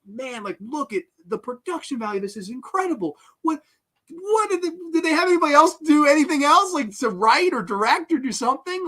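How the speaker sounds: high-pitched, incredulous gushing; retold amazement. Acting out his amazed viewing reaction.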